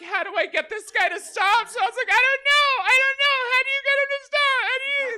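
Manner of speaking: shrill high loud mock yell; frantic, fed-up guessing; exasperated shout. Comic shouting back at stranger's riddle.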